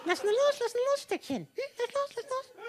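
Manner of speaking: high coaxing voice for a dog; talking to a dog. Pretend. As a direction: coax him like a pet dog. Talks to someone as to a dog. (wie mit einem Hund reden)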